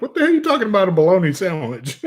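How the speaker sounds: mock stunned wife; imitating baffled wife. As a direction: squeal her disbelief from the kitchen. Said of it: "She's in the kitchen right now like".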